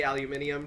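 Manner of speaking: "aluminium" with five syllables, al-yoo-MIN-ee-um; the British "aluminium", not the American one. Shown as a demonstration. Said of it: "I usually say it the British way".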